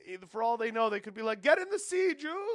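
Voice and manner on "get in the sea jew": very high, loud mock yell; high-pitched shout, played for laughs. What strangers might hear from a car, yelled. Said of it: "they don't know what you're yelling".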